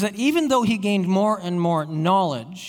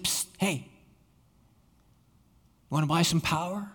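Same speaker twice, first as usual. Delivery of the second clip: hushed, sly dealer pitch; secretive raspy sales murmur; 2 semitones lower, 7 dB quieter than usual. Acted. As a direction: lean in like a shady street seller. Satan voiced as a secretive salesman.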